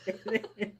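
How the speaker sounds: quick words through laughter; laughing. Real, not acted. Cracking up at own cartoon joke.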